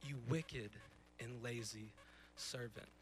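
master's judgment sinking to a soft, low verdict; stern master. Retold or imitated. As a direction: let the rebuke drop low and quiet. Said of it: "what this master says to his servant".

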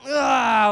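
high, loud effortful "Ah"; loud strained ah. Retold or imitated. Husband stretching the camera, loud strained ah.

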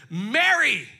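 called out loud; loud calling of a name; exclaimed. Acted. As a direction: call her name out with feeling. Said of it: "with an exclamation point".

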